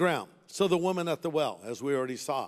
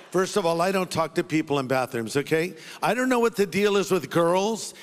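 ordinary delivery

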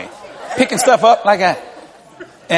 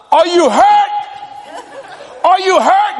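worried dad calling; 12 semitones higher, slower than usual. Retold or imitated. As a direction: call out in alarm. Worried dad calls from car.

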